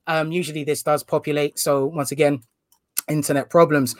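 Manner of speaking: ordinary talk, nothing marked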